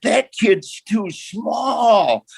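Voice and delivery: slow Texan twang building to a yell; Texas voice. Retold or imitated. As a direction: do the old Texas coach, drawn out and rising to a holler. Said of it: "this Texas voice going".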